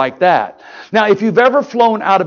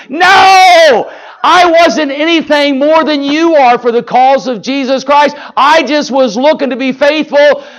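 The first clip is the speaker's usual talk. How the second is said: high, loud, forceful rebuttal; impassioned preaching; 8 semitones higher, 6 dB louder than usual. Real, not acted. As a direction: shout the denial, then keep preaching hard. Rejects mock celebrity worship, preaching forcefully.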